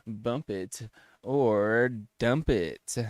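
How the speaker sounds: slow, drawn-out game title; game show announcer. Acted.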